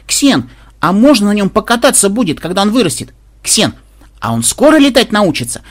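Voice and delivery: high, loud eager pestering; excited granddaughter. A narrator's reading. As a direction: beg eagerly like an excited girl. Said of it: "с визгом бежала внучка". (звонко, нетерпеливо выпрашивая)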